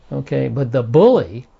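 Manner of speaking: ordinary talk, nothing marked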